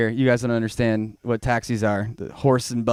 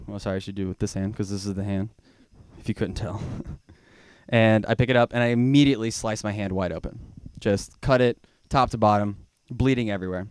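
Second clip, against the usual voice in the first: ordinary talk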